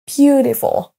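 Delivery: "Beautiful" as BEAU-ti-ful; first-syllable stress. Shown as a demonstration. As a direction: hit BEAU in "Beautiful", let the rest fall. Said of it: "accentués sur la première syllabe".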